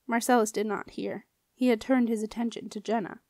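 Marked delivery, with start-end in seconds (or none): none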